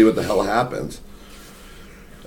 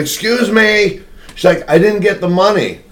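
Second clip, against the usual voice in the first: mock yell; 8 semitones higher than usual. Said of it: "girl who works there starts screaming".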